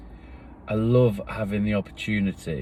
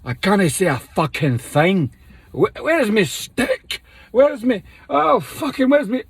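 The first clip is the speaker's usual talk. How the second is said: put-on much higher and louder Scottish drunk voice; blind Scotsman; 12 semitones higher, 6 dB louder than usual. Showing the uni party character the blind Scotsman in a high, loud Scottish voice. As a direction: bellow like a lost drunk Scot hunting for his stick. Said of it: "I do the blind Scotsman".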